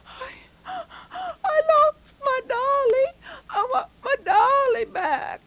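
put-on very high, loud tearful girl; little girl crying. Joan tearfully begs the captain for her doll, very high and loud. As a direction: plead through tears for the doll. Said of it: "she said".

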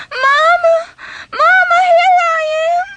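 put-on a sobbing child calling his mother; crying out to Mama. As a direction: shout so she finds you. Boy cries out to mother.

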